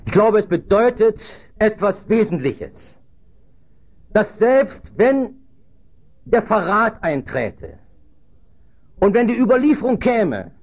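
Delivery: high, measured pulpit proclamation; emphatic preaching. Real, not acted. Preacher explains the betrayal to the congregation, emphatic. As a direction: drive each phrase home with pauses between.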